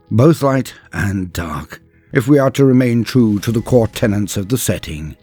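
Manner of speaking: plain reading voice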